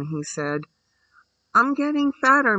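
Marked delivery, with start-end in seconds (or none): 1.5-2.6 put-on stiff child's answer, higher and louder. A narrator's reading. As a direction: answer stiffly, pitched high and firm. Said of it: "answered in what she knew was her stiffest way".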